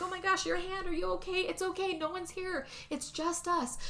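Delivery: high, soft acted reassurance; hushed, worried comforting voice, imitated; soothing concern. Husband calming his hurt wife, retold soothing concern.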